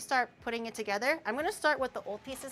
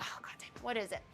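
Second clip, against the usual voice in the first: grumbling dad; 10 dB quieter, faster than usual. Retold or imitated. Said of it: "He's like".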